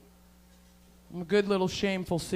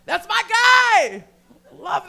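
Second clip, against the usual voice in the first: high, loud, drawn-out cheer; gleeful stretched-out yell; proud shout; 10 semitones higher, 9 dB louder, much slower than usual. Acted. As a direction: holler it like a proud fan. Proudly claims the dirtbag type.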